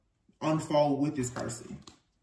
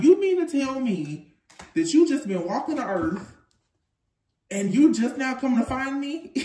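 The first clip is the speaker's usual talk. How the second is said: excited quote; 9 semitones higher, 5 dB louder than usual. Retold or imitated. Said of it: "You're like".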